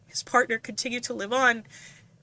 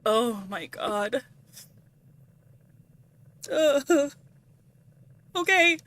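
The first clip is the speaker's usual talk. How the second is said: ordinary delivery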